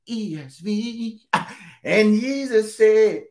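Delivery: high, slow mock preacher voice laughing through the words; mocking a credit-stealer. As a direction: mimic a churchgoer bragging the Holy Ghost showed them, chuckling. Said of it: "some of y'all will take it".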